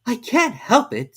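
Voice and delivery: put-on high, slow, apologetic girl; high, slow meek apology; meek Alice. A narrator's reading. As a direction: say it meekly, as a little girl. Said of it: "said Alice very meekly".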